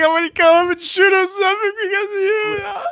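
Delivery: high, shouted mock-tough voice; mock threat. Friend voicing an angry stranger, shouting a mock threat. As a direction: yell it like a hothead picking a fight. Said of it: "That's like".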